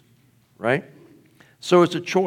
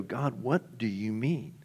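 quiet prayer; 8 dB quieter than usual. Retold or imitated. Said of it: "sit down with the parable and go".

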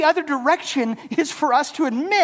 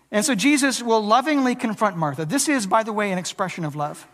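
ordinary talk, nothing marked

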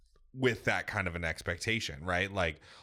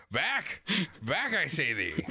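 mock knightly; 8 semitones higher, slower than usual. Imagined defending himself, archaic.

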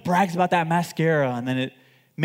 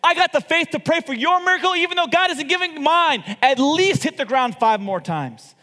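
much higher and louder, furious rebuke; angry shout; 9 semitones higher, 5 dB louder than usual. Retold or imitated. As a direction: yell at the king from a deathbed. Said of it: "he gets angry at the king".

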